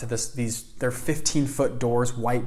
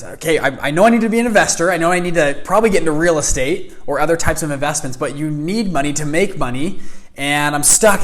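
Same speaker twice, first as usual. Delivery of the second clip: loud, raised-pitch torrent of problems; frustrated rambling; 3 semitones higher, 6 dB louder than usual. Retold or imitated. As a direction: pour out your troubles to a mentor, insistently. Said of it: "I'm just rambling off to this guy".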